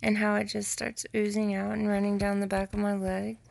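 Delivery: normal talking voice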